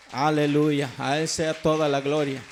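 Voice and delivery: loud, projected hallelujah; exclaimed praise. Real, not acted. Preacher gives God the glory after his testimony, loud praise. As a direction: proclaim it strongly to the congregation.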